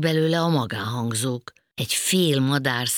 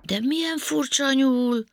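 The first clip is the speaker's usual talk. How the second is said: higher wondering voice; a touch of amazement; amazed; 11 semitones higher, 4 dB louder than usual. A narrator's reading. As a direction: puzzle over the odd rabbit. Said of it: "ámuldozott Panka".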